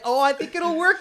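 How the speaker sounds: put-on high, loud, hopeful kid voice; pleading child. Child on video call assuring Dad, high and eager.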